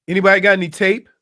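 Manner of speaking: ordinary talk, nothing marked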